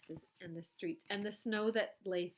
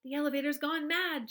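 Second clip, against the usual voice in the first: high, loud alarmed shout; 7 semitones higher, 8 dB louder than usual. A narrator's reading. Grandpa Joe shouting that the elevator's gone mad. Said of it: "shouted Grandpa Joe".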